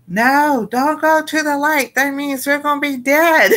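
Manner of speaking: high, panicky plea breaking into a laugh; mock panic. Kai warns his friends away from the light, high and panicky. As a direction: squeal it like a scared kid who half-jokes.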